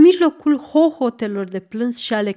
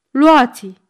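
loud, higher-pitched exclamation; strigăt; 4 semitones higher, 8 dB louder than usual. A narrator's reading. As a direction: cry it out sharply to the mistress. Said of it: "strigându-i și întinzându-i". (strigă tare, mai înalt)

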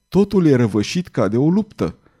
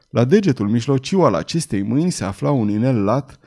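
ordinary delivery, nothing marked

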